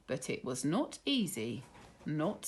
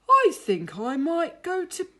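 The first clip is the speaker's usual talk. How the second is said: slow, drowsy bear voice; a tired bear ready for bed; sleepy; 5 semitones higher, 8 dB louder than usual. Acted. Boris sleepy after the day.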